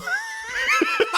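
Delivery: helpless high-pitched cackle; high shrieking laugh. Real, not acted. Co-host cracking up at teasing, high shrieking laugh. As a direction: crack up in a squeal.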